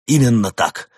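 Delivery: loud, high, triumphant exclamation; full of pathos. A narrator's reading. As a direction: proclaim it with grand pathos. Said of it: "с пафосом воскликнул полковник".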